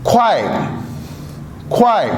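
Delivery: crisp falling kuài. Shown as a demonstration. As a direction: drop ख्वाए hard like Mandarin fourth tone. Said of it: "falling tone के साथ".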